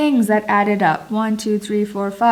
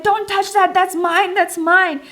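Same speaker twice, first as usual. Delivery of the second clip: high-pitched, frantic protest, not shouted; re-enacted freak-out; 9 semitones higher than usual. Wife freaking out at husband touching her thing, mock-frantic. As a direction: imitate someone freaking out over their belongings. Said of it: "I start freaking out and I'm like".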